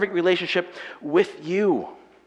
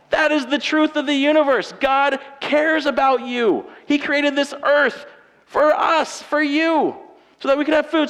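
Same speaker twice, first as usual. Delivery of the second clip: higher and louder, building fervour; full-force preaching; 8 semitones higher, 4 dB louder than usual. Acted. Pastor preaching to the congregation that God cares, at full force. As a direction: preach it out with conviction.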